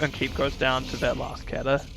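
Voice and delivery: ordinary talk, nothing marked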